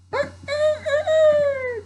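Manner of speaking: shrieked rooster call; cockerel crowing. A narrator's reading. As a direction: crow loudly like a rooster. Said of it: "the cockerel crowed".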